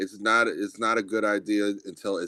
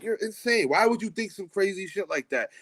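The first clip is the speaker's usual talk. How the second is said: high-pitched imitation of scoffing critics; high, mocking retold disbelief; 8 semitones higher than usual. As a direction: mimic incredulous doubters, pitched well up. Mimics people scoffing at his ideas.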